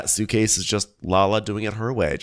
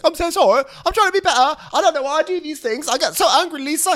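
much higher, louder falsetto pleading; mock whiny apology; 4 dB louder, faster than usual. Mocking James apologising to his girlfriend, squeaky falsetto. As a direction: whimper a fake sorry in a squeaky voice. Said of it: "then be like".

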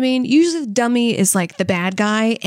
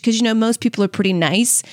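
usual speaking voice

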